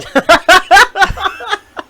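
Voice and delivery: loud, high burst of laughter; real laugh. Real, not acted. Teller with no answer for the friend, laughing loudly.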